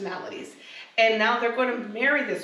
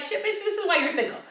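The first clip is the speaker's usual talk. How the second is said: mock exasperated verdict; 9 semitones higher, faster than usual. Blunt verdict on a messy partner.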